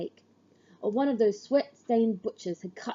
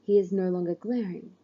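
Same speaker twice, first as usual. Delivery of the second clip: ordinary delivery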